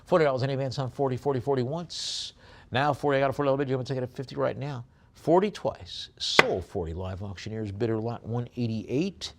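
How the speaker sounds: fast, high, loud auctioneer chant; rapid-fire auction call; auction bid calling. Acted. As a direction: rattle off the bids like a live auctioneer.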